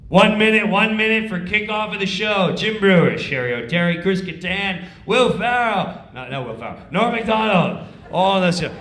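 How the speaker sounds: loud call across the studio; stage manager call. Retold or imitated. Comic imitates stage manager call, loud. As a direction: summon the cast.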